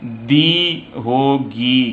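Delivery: दी said slowly as "dee"; slow model of "dee". Shown as a demonstration. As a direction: stretch दी as a clear "dee". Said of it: "इसकी pronunciation".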